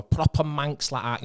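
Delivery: slow, exaggerated Manchester accent on "proper Manx"; mock Manchester accent. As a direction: lay the Manchester accent on thick with disdain. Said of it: "who speak like that".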